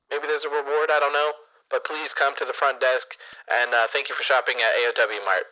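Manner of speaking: echoey store PA page; PA announcement. Pretend. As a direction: page a customer over the intercom. Store PA call for missing Philip.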